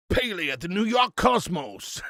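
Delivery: mock American accent. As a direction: drawl it like an American commentator. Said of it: "some Americans, that call him Pele".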